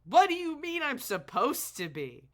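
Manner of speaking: loud, high outraged retort; indignant shout. Real, not acted. Streamer shouting back at the game character, outraged. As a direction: shout it back, offended and incredulous. Streamer mocks the sister's line.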